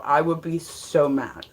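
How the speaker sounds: usual speaking voice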